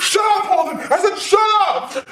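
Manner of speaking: very high-pitched angry shout; furious order. Acted. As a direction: yell to silence him. Roommate ordering him quiet in fight.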